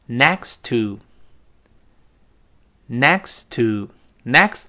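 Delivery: "next to" slow, a slight hitch before "to"; stopped t. Shown as a demonstration. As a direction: hold the t in "next" unreleased, then say "to". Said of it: "稍微一顿挫就发那个to的音". (“next”的t顿住不爆破，再读“to”)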